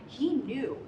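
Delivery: normal talking voice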